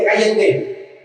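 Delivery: milder first exclamation; exclaimed hushing. Retold or imitated. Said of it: "de admiración ahí".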